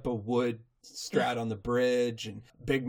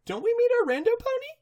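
very high, fast playful shout; sing-song announcement; hammed-up announce; 4 dB louder than usual. To co-host, hammed-up high shout.

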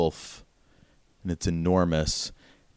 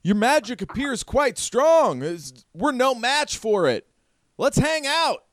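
much higher put-on voice; stranger's character voice. Stranger addressing the magic user, high character voice. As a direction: pitch this stranger way up. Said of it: "The guy says".

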